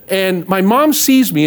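ordinary talk

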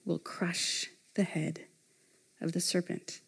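very soft, slowing right down; soft, weighty re-reading; hushed solemnity. Real, not acted. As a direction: let each phrase land quietly. Solemn re-reading of key idea.

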